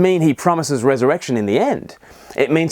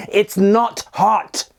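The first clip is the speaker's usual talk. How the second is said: slow, higher-pitched mock parental insistence; exasperated parent spelling it out very slowly; insisting to toddler; 6 semitones higher, much slower than usual. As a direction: drag out each word as if to a bawling toddler. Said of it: "insisting to your bawling toddler".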